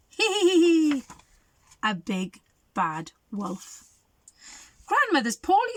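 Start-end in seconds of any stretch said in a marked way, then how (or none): 0.1-1.0 high wicked cackle; evil cackle. Acted. Wolf's evil laugh. 4.9-5.8 mother's voice. Acted.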